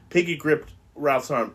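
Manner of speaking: usual speaking voice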